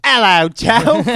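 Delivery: high, loud, over-the-top greeting; shouted Aussie hello; excited Aussie midget voice. Acted. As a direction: burst in as a hyped little Australian man. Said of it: "That was terrible".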